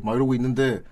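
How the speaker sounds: ordinary delivery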